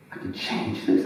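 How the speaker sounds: low, soft retold question; quiet, tentative wonder; hushed disbelief. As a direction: ask it softly, as if it's only dawning on you. Retelling his naive dawning realization.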